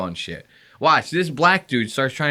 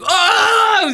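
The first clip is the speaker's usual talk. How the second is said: loud, high, drawn-out crowd reaction; 5 dB louder than usual. Retold or imitated. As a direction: imitate a crowd roaring "oh". Imitates the whole crowd reacting.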